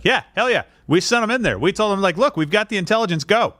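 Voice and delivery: high, emphatic acted cheer; gung-ho backing. Acts out backing the airstrikes.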